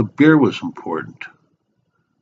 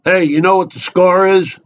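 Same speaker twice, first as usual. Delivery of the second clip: higher, insistent stranger's voice; chatty barfly; 8 semitones higher, 4 dB louder than usual. Acted. Stranger keeps at a man minding his beer, insistent barfly. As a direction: pester them like a pushy bar regular.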